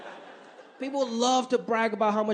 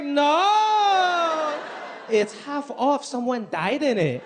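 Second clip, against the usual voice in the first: boasting mom impression; 4 semitones higher, much slower than usual. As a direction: shout the no, then gloat about the deal. Mom voiced in story, shouted.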